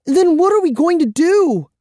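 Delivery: put-on very high, loud frightened girl; shrill panic. A narrator's reading. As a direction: cry out the question in panic. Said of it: "Kara asked shrilly".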